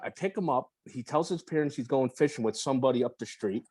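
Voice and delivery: ordinary talk, nothing marked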